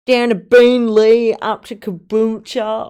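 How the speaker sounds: slow, loud mock-Australian drawl; hammed-up broad Australian, imitating own voice; exaggerated Australian accent. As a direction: blare it out in overdone Aussie. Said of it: "You just sound Australian to me".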